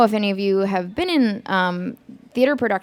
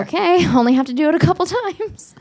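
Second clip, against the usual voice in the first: high, fast, giggling reassurance; half-laughing mock bravado; laughing nervous okay; 8 semitones higher, faster than usual. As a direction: laugh through it, brushing off the worry. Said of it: "And I was like".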